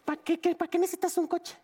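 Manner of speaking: high-pitched, exasperated mom voice; a mother losing patience with her spoiled son; scolding mother. Acted.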